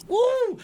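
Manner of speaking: loud, high party whoop; excited woo. Real, not acted. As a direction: whoop to hype the crowd. Hyping the guest's arrival.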